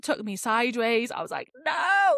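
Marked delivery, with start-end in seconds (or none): 1.6-2.1 high mock wail of disbelief; dismayed cry. Vlogger replaying her film reaction, dismayed cry. Re-enacting reaction to character's death.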